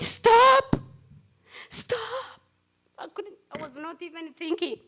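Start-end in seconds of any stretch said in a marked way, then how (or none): 0.0-2.4 high loud cry fading on the repeat; calling out stop. Retold or imitated. To the fleeing woman, a high shout of stop. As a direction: yell after her, then lose breath.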